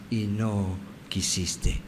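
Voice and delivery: low, slow and soft sorrow; sad lament. Acted. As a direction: let it drop, slow and hushed with sadness. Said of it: "llenas de amor y de tristeza". (con tristeza, lento y bajo)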